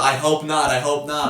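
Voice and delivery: high, loud American "I hope not"; hammed-up cheery American owner; mock American. Owner laughing off free pints in Carlisle, mock American. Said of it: "doing that good of an impression".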